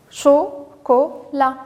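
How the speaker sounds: "chocolat" in three slow separate beats, cho, co, la; syllable by syllable. Shown as a demonstration. Teacher giving children the assembled word, "chocolat" said syllable by syllable. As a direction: break "chocolat" apart with a pause after each syllable. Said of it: "on obtiendra le mot".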